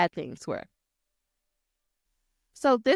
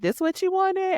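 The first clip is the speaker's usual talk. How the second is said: high, disbelieving mock question; incredulous friend; 11 semitones higher than usual. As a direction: voice the friend's stunned look, pitched up. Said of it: "the look on his face was like".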